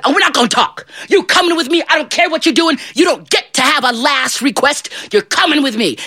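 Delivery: high-pitched, overbearing tirade; angry devil. Acted. Angry father devil comes to drag John off, ranting. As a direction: order him along, allowing no argument.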